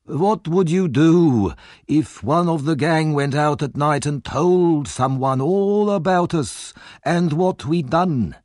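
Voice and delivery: put-on high, creaking old man's voice, slow and sinister; sly old villain. A narrator's reading. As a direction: needle him with a hateful question.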